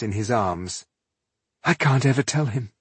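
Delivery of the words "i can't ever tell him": unhappy thought. A narrator's reading.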